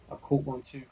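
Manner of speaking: loud eager cry. A narrator's reading. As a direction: call out eagerly. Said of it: "cries cold-eared Carl".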